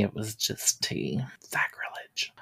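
hushed whisper. Acted. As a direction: whisper it like a scandal. Tea joke.

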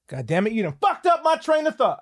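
annoyed yell, not aimed at anyone; yelling at dog. Real, not acted. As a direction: blow up at the dog for breaking his thought. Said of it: "I'm yelling".

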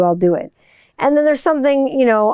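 ordinary delivery

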